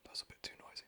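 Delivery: very soft, voiceless aside; whisper. Real, not acted. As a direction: whisper it close to the mic. ASMR aside after bubbling.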